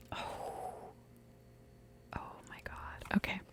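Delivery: barely audible whispered reaction; stunned whisper. Real, not acted. Reader reacts to the cards, stunned whisper. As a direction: breathe it out under your breath, stunned.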